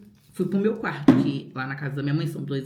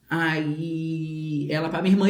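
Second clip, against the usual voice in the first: usual speaking voice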